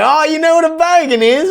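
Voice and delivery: put-on squeal-pitched question in an Australian accent; imitating surprised Australians. Australians amazed he knows their slang, high mock Australian accent. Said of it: "they're like".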